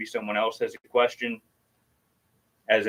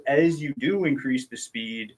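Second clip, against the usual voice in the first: normal talking voice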